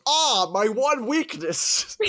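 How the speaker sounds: high, loud, drawn-out wail; mock agonised cry. Cyclops stabbed in his eye, hammed-up wail. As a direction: wail it slowly in hammed-up agony.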